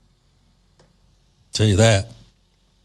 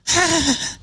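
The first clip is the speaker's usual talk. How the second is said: high giggle; 13 semitones higher than usual. Real, not acted. Laughing at his own impression.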